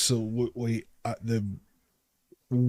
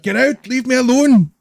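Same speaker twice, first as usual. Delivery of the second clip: high, loud frantic shout; panicked yelling; 12 semitones higher, 8 dB louder than usual. Acted. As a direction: freak out and yell them away. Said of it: "he's just freaking out".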